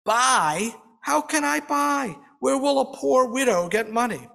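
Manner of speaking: a lightly voiced poor widow complaining bitterly; bitter complaint. A narrator's reading. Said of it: "She complains bitterly".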